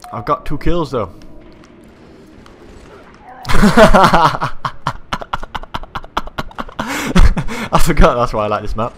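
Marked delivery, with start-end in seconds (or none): 3.3-7.7 high, helpless cackling laugh; long loud laugh. Real, not acted. Player cracking up at the game, laughing hard.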